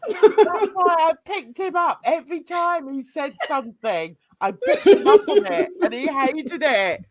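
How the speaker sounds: high-pitched words through laughter; gleeful laughing. Real, not acted. Recalling how he hated being corrected, gleeful laughter. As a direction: talk while cracking up.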